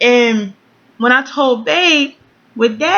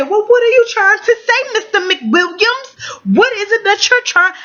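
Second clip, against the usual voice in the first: much higher, indignant mock outburst; flipping out; 12 semitones higher than usual. Pregnant woman confronting her partner, re-enacted flipping out. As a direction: confront him in a high, exasperated voice. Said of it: "I flipped the fuck out".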